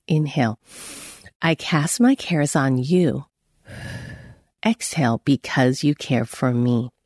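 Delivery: slow, low, paced with the breath; slow breath prayer. Shown as a demonstration. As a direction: breathe it out slowly and gently. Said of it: "Mine is First Peter five seven".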